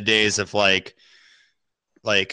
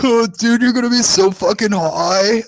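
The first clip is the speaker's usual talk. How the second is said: excited buddy; 10 semitones higher, 3 dB louder than usual. Retold or imitated. Buddy warns him, retold.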